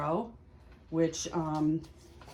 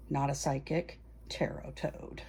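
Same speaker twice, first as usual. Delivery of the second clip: breathy whispered joke under the breath; whispered aside; 4 semitones lower, 7 dB quieter than usual. Real, not acted. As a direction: whisper it as a sly aside to the viewer. Whispered self-mocking aside.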